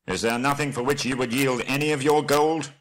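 high-pitched urgent appeal; Bard, earnest envoy. Acted. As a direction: press the bargain from below the gate. Bard bargains with Thorin.

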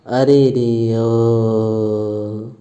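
low, very slow drawn-out groan; long, low cry of pain; sharp groan. Acted. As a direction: let the pain pull the sound long. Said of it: "तेजी से कराकर बोलता है".

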